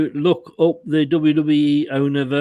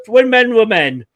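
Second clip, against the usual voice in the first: emphatic; 7 semitones higher, 7 dB louder than usual. Real, not acted. Nostalgic boast about old wrestling.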